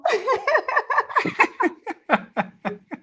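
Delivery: long, high peal of laughter. Real, not acted. Host trying a dialect greeting with guest, laughing high. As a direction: giggle on and on, high-pitched.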